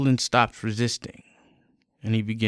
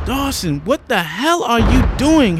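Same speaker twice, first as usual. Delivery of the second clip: higher, loud scolding; angry scolding; 12 semitones higher, 8 dB louder than usual. Acted. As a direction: tear into him in outrage. Lisa scolds Dawson angrily.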